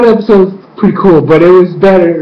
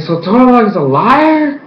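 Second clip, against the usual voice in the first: can't believe what he heard; disbelief; 7 semitones higher than usual. Retold or imitated. Vlogger reacting to the twist, incredulous. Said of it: "And I was like".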